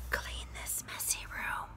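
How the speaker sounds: soft whisper; mother whispering with company over. Shown as a demonstration. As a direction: whisper it so company won't hear. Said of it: "whispered Mother".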